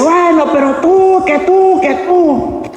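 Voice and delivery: high-pitched retort in an acted row; mock yelling back. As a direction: shout the blame back, pitched high, like a squabbling spouse. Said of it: "Si a usted le gritan". (réplica a gritos, fingida)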